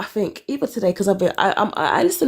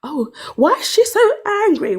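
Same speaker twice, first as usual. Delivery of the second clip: puzzled high quote; 10 semitones higher, 4 dB louder than usual. Retold or imitated. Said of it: "I was like".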